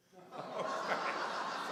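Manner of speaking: loud breathy burst of laughter; laughter bursting out, overcome with emotion. Real, not acted. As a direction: let a big laugh burst out. Moved by daughter's words.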